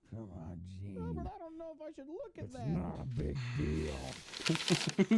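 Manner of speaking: mock dad mumble. Dad muttering to Gene behind the paper, soft. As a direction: mumble both parts. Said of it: "The dad's like".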